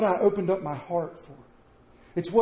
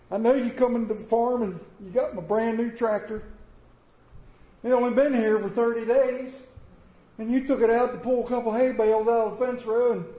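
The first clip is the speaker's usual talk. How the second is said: lightly voiced farmer, higher; forgiving farmer; 7 semitones higher than usual. Farmer tells a neighbor about the scratched tractor, lightly voiced. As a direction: talk it through like a patient farmer.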